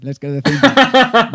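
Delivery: high and loud, gleefully insistent; gleeful insistence. Retold or imitated. Driver overrules bandmate, loud high glee. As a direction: crow it out like a delighted kid.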